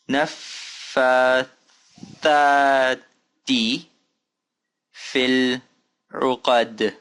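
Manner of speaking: long held syllables, wide pauses; slow careful recitation. Shown as a demonstration. Teacher asking learners to follow along, slow and spaced. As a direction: read it slowly so others can follow. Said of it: "follow along".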